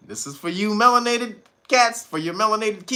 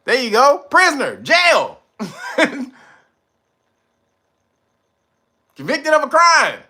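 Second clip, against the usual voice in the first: triumphant answer; 7 semitones higher, 5 dB louder than usual. Real, not acted. Audience found the answer.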